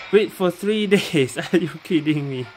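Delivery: loud, high, slowed disbelief with a laugh breaking in; incredulous outcry. Real, not acted. Streamer balking at a three-day wait, loud incredulous laughing protest.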